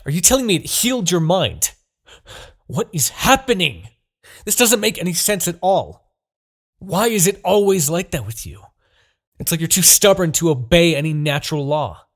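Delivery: high, loud disbelieving rant; exasperated outburst. Acted. Demon king ranting at the captive the sword has freed. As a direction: throw up your hands in fury.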